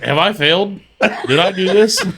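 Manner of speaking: lightly voiced despairing father; despairing dad. Friend imitates the girl's dad, half laughing in despair. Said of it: "He's always like".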